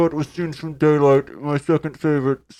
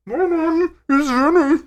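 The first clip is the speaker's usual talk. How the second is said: character voice; 12 semitones higher than usual. Acted. Guest character voice, high and far.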